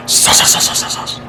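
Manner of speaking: whispered playful chant; whispering. Real, not acted.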